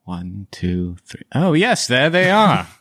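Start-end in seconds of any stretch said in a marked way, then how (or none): 1.3-2.7 high, doddering gentleman pleased with himself; delighted Van Richten. Acted. Man finds glasses and thanks helper, doddering. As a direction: exclaim thanks in a raised old-fellow voice.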